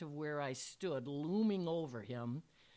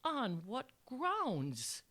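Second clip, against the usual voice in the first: high, slow, wide-eyed innocence; feigned innocent disbelief, drawn out; incredulous innocence; 6 semitones higher, much slower than usual. A narrator's reading. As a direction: ask it slowly, utterly incredulous. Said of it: "asked in total and incredulous innocence".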